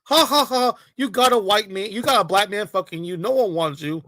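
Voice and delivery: hammed-up imitation of gloating jeerers; mocking laughter. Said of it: "they're laughing, like, it's all a joke".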